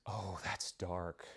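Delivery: mock-appalled coworker, hushed and slow; horrified hush. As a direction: say it like a coworker recoiling in horror. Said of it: "she was like".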